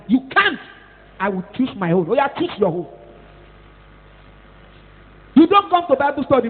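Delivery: normal talking voice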